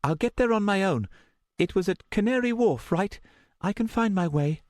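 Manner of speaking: put-on high, steady young woman's voice; determined and controlled, pitched high; steady, resolved. A narrator's reading. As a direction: hold firm in a high voice. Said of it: "her voice was steady".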